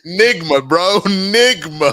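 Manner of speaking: loud laughing correction, Nigma. Real, not acted. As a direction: shout "Nigma" through laughter, clearly with a hard g. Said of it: "corrects the name loudly through laughter".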